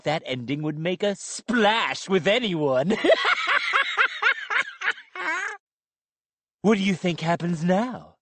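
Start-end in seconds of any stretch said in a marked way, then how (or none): 3.0-5.5 high, mocking dummy laugh; a gleeful puppet cackle; evil cackle. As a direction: cackle at your own joke.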